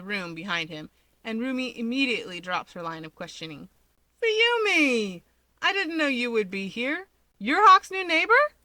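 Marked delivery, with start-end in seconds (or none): 4.2-8.5 high, loud, thrilled to see a friend; light up with joyful surprise; delighted greeting. Acted. Rumi spotting her friend unexpectedly, excited and loud.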